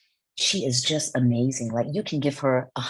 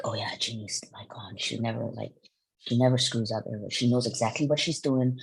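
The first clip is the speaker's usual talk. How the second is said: hushed gossipy whisper; 6 semitones lower, 6 dB quieter than usual. Retold or imitated. Crew gossip quoted in whisper.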